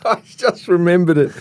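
high, laughing recollection; cracking up at a memory; real laughing fit. Real, not acted. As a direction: talk through the giggles. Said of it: "I've gotta compose myself".

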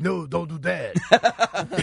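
drawn-out, slow mock French; slow, laughing French-accent imitation. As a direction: tease with an exaggerated French accent. Mimics the French accent just named.

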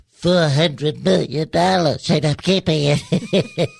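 slow cartoon-villain Trump voice; evil Trump. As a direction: draw it out like a gloating villain. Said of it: "when I do evil Trump".

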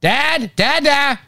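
put-on high, calling toddler voice; imitating a shouting toddler. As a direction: mimic a little girl yelling for her dad. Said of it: "at the top of her lungs".

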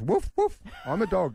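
very high, yappy woof from a small boy pretending to be a dog; little boy playing dog. Son Otis insisting to dad that he's a dog, high yappy kid voice. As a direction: bark it out like a four-year-old who thinks he's a puppy. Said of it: "Otis looks up and goes".

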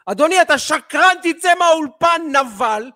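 host pretending to shout at a guest; mock shouting insult. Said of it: "אני לא אגיד לו".

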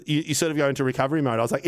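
ordinary talk

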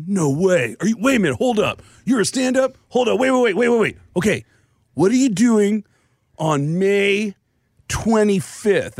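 high, rising excited stammer; can't believe it, high-pitched. Retold or imitated. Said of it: "And he goes".

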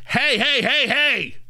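mock mom voice warning. Shown as a demonstration. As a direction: bark it like a mom scaring off a moose. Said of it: "Get ready with your mom voice".

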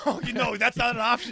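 high, loud, incredulous objection; excited protest. Real, not acted. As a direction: shoot the idea down loudly. Teasing protest about movie choice.

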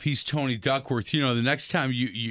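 ordinary talk

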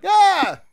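sudden high loud yelp; involuntary neigh cry. Shown as a demonstration. Showing what an involuntary neigh sounds like, a high loud yelp. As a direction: let out a sharp startled cry. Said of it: "So it's like me going".

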